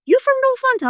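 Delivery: high, loud, excited query; imitating an astonished stranger; amazed question. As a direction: fire it off high in disbelief. Retold men's amazed question.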